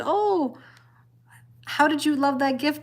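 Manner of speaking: innocent, upbeat gushing; retold excited question. Retelling her innocent excited question to boy.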